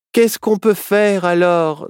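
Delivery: high, slow, drawn-out wail; plaintive young-fox lament.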